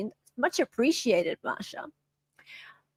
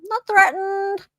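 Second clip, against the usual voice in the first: mock defiance; 8 semitones higher, 3 dB louder, slower than usual. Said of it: "Not threatening".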